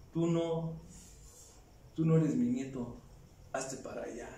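low, quiet and cold, fading away; cold rejection. Retold or imitated. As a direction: push the boy aside quietly. Abuelo rechaza al nieto. (abuelo rechazando al nieto, bajo y frío)

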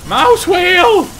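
high, loud frustrated cry; frustrated shout. Real, not acted. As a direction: yell at the broken control. Frustrated outburst.

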